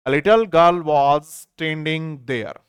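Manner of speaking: very slow, read through in one go; now read the whole sentence slowly. Shown as a demonstration. Teacher rereading the full sentence to students, slow and whole. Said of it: "एक छोटी लड़की वहां खड़ी थी".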